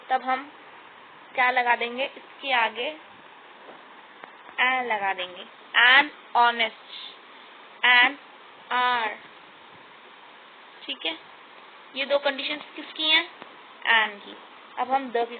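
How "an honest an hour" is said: louder, very slow "an honest, an hour" without h; silent h demo. Shown as a demonstration. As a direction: stretch out "an honest", drop the h. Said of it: "ऑनेस्ट बोलते हैं".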